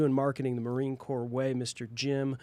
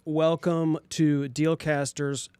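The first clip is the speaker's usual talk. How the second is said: grand entrance announcement; 2 semitones higher, 3 dB louder, slower than usual. Acted. Show opening announcement.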